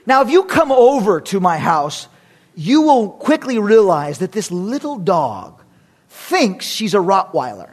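normal talking voice

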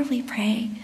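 breathy whispered close of a prayer; softly whispered "we pray". Real, not acted. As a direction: let "we pray" fall to a whisper.

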